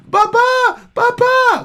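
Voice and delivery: put-on very high, loud baby babble; toddler voice. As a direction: imitate a toddler crying for his pacifier, high. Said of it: "he starts going".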